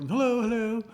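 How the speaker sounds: high, bright-voiced hello; polite neighbor greeting. Shown as a demonstration. Welcome lady greeting Myra, in a high sing-song. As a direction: chirp a sweet hello at the door. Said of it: "but like".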